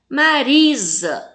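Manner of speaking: "Marisa" slowly, RI drawn out; long, emphatic RI in "Marisa"; tonic syllable stressed. Shown as a demonstration. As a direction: lean on RI in "Marisa". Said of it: "fala com mais intensidade".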